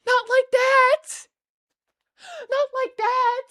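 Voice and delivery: high, loud squeal of protest; horrified squeal. Real, not acted. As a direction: squeal it in disbelief. Horrified at being misdescribed.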